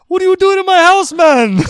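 very high, very loud indignant yell; shrieked confrontation, hammed up; imitated angry homeowner. Angry homeowner confronts him in story.

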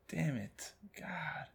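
soft, fading curse down to a whisper; a golfer quietly cursing a lost ball; muttered frustration. Retold or imitated.

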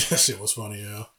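ordinary delivery

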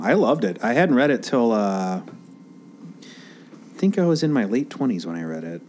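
ordinary delivery

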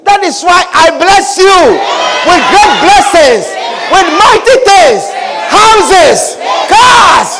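high, loud declarations of blessing; fervent preacher pronouncing blessings in bursts; full-force preaching. Real, not acted.

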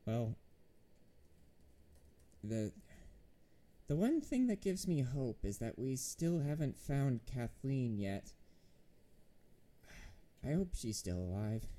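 halting, sighing delivery with long pauses; mild, resigned in-character sigh; weary sigh. Acted. Injured lady sighs to the party after battle, weary and hesitant. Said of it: "sighs and says".

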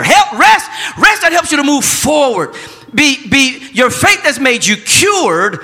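loud, drawn-out preaching, high cries on rest; preaching at full force. Acted. Pastor declaring healing to the congregation, full preaching cry. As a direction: preach rest over them, building and stretching.

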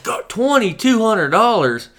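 very high, loud, drawn-out disbelief; mock shock. Customer hearing the scope's price, exaggerated shock. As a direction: exclaim it as if floored.

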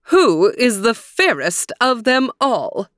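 haughty, commanding high queen voice; demanding queen. Acted. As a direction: demand an answer like a vain queen. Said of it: "she demanded".

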